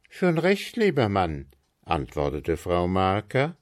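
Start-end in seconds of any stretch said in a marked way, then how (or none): 0.1-1.5 agreeable wife. A narrator's reading. Frau Marker answers.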